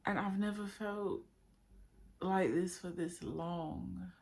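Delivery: ordinary delivery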